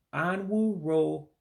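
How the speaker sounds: drawn-out start of Anwuro-; real slow. Shown as a demonstration. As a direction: say Anwuro- slowly and break off. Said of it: "Let me say it real slow".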